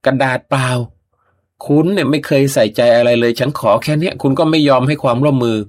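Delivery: high, loud scolding wife; angry outburst. Acted. Wife yelling at husband over the blank paper, loud angry outburst. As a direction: let her blow up at him. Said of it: "โวยวาย".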